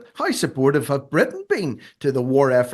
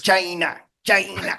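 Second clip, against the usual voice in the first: mock Trump "China", stretched "Chy-na"; hammed-up Trump impression of "China"; Donald Trump accent; 6 semitones higher, slower than usual. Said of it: "doing it in a Donald Trump accent".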